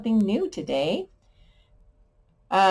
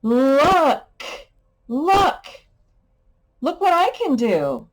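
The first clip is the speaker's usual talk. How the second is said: much higher, louder showing off; excited kid; 7 semitones higher, 4 dB louder, slower than usual. Pretend. Teacher acting a child showing off: excited high voice. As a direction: call out like a proud little kid. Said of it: "child showing off".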